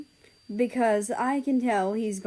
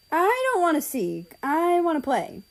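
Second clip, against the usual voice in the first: put-on higher, childish protest; playful little brother; 7 semitones higher than usual. Ozzy refusing to be the seeker, childish protest. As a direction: whine like a kid who only wants to play. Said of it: "I hear Ozzy say".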